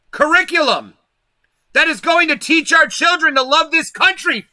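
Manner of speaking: rally the crowd with the good news; excited shout. Real, not acted. Vlogger cheering the new curriculum to viewers, shouting.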